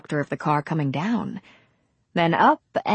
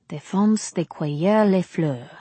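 French. A narrator's reading. French sign read aloud.

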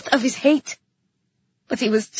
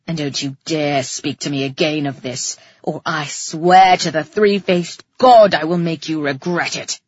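low, menacing male threat; snarling; 9 semitones lower, 3 dB louder than usual. A narrator's reading. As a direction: threaten her in a low-pitched growl. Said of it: "he snarled".

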